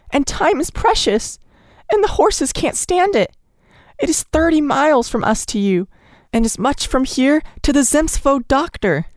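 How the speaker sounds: high, urgent pleading, near tears; desperate pleading. A narrator's reading. Nellie begging Stepan Lukic to come, high and desperate. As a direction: beg for a heroic deed.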